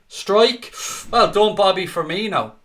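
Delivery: loud, high cheer on the strike; excited shout. Real, not acted. Fan on stream cheering Firmino's shot, excited shout. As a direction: burst out as the shot goes in.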